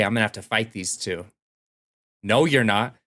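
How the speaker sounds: ordinary delivery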